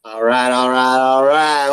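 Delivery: drawn-out catchphrase, climbing steadily; actor's catchphrase. Acted. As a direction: stretch each one out, building upward. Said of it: "I love him as an actor".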